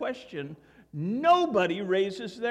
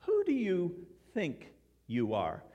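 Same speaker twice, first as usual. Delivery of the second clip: measured "Who do you THINK you are?", "think" set apart; stress on THINK. Shown as a demonstration. Pastor repeating the intonation he wants for us, "think" set apart. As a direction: pause before and after "think", asking sincerely. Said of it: "that's the intonation that I, that I want".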